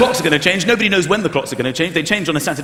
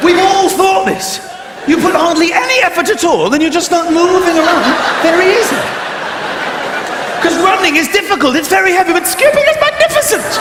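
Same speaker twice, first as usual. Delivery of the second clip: building to a giddy squeal on magnificent; excited; 8 semitones higher than usual. Real, not acted. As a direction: rave about it breathlessly. Excited rant about skipping.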